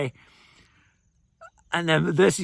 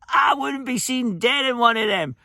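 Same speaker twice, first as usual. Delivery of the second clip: high-pitched imitated indignation; mock scorn; 9 semitones higher than usual. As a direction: play up her horror at being seen in one. Retold scornful reply about the Skoda.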